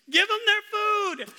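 high, loud, stretched-out yell; exasperated shout. Retold or imitated. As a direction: holler it slowly at the slow server. Retold outburst at drive-through delay.

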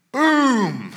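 a sudden loud Boom! shouted high and hard; mock crash sound. Preacher acting out his fall on the trail, loud mock crash. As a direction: slam the word out like hitting the ground.

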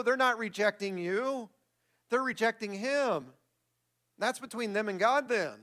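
ordinary talk

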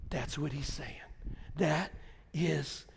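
very soft, low, nearly whispered sermon; hushed preaching. Acted. Pastor's quiet altar call to the congregation, near whisper. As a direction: bring it down to an intimate hush.

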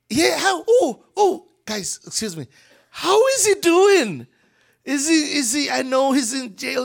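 high, loud delighted greeting with a laugh. Acted. Jesus greeting his cousin's disciples, delighted greeting. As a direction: greet old friends with joy.

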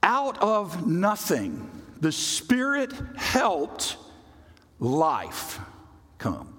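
slow, high, drawn-out preaching that sinks at the end; slow dramatic build. Acted. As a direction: stretch it out like a revelation.

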